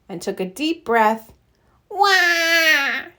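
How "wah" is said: high imitated baby cry "wah"; mock infant crying; baby wail. A narrator's reading. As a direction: wail like a newborn. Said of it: "she wailed".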